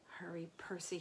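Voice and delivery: soft unvoiced whisper; whispered mutter. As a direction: whisper it under your breath. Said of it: "he muttered".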